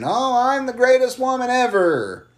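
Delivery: high, mocking boast; smug boast. Podcaster mocks Charlotte's promo, smug high boast. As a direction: mimic a full-of-herself champion boasting. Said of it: "Charlotte coming out and being".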